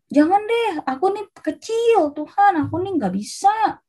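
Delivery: high, drawn-out protest; pleading refusal. Acted. Gideão recusa a missão.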